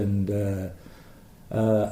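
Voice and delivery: ordinary talk, nothing marked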